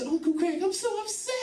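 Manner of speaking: put-on high whiny kid voice, a touch upset; mock upset teen niece. Comic telling uncle about braces, whiny high girl voice. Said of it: "So she says".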